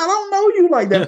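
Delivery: high-pitched mock-indignant comeback; taken aback. Man answering a lady who says she hates him, taken aback. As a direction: push back in high, put-on disbelief. Retold comeback to a hostile stranger.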